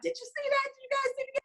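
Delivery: proud mom showing off; excited bragging. Retold or imitated. Mom asking coworkers about the game, excited and proud.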